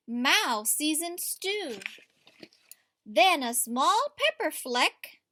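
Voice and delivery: lively read-aloud to a class; story reading to children. A narrator's reading. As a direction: read it like a picture book at story time. Teacher reads picture book aloud.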